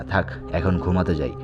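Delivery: usual speaking voice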